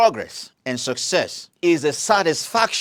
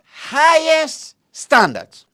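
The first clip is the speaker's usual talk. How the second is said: Highest standards drawn out slow, higher and louder; emphatic slow stress; 11 semitones higher, 5 dB louder, much slower than usual. Shown as a demonstration. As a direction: stretch out Highest standards like a key term. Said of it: "Underline the word highest standard".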